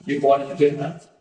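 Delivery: ordinary delivery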